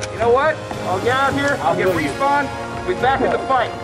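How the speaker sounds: ordinary talk, nothing marked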